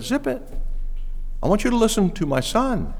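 high-pitched cut-in as God the Father; God interrupting. Acted. God the Father silencing babbling Peter, high-pitched interruption. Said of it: "He interrupted Peter. And he said".

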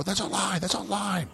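high, sharp imitation of a barrier guard yelling; mock guard shout. Imitating a guard at the barrier, high mock shout. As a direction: squeak it out like a frazzled guard.

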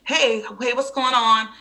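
high, loud greeting, imitated; mock the doctor's late, breezy arrival. Doctor greeting suffering patient at two AM, breezy.

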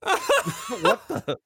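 high squeaky laughing disbelief; stunned laughing. Retold or imitated. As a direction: laugh it out in shock.

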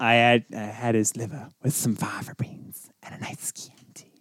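soft, sinister whispered menace; creepy Hannibal Lecter whisper. Retold or imitated. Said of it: "that's not how he did it".

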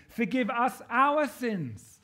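"our" drawn out, high and loud; emphatic, stretched "our" for the collective; stress on OUR. Shown as a demonstration. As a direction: lean hard and slowly on "our". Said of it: "Not just me of mine".

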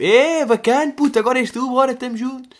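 high, loud and eager, cheering a friend on; a hammed-up high voice urging a mate forward; hyped-up gym buddy. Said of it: "foi tipo".

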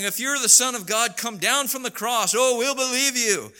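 high, sneering mock taunt; a crowd of mockers taunting Jesus. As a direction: jeer at him from below the cross. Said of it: "making fun of him and saying".

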